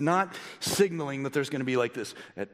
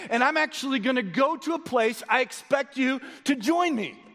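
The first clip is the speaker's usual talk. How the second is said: high, raised preacher's voice; full-force pulpit exhortation; impassioned preaching; 11 semitones higher, 4 dB louder than usual. Real, not acted. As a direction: exhort the congregation with rising urgency.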